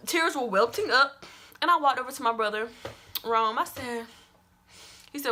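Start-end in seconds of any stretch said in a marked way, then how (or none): none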